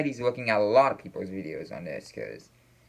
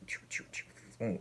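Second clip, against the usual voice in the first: whispered sound effect; 14 dB quieter, slower than usual. Retold or imitated. Mimicking posts being shared.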